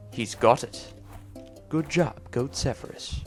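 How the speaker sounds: ordinary talk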